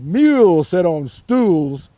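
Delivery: loud and slow, rhyme spelled out; cat's slow pronouncement. A narrator's reading. The cat telling the frog the rule, loud and slow. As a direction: lay down the rule deliberately. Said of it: "said the cat".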